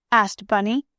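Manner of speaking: usual speaking voice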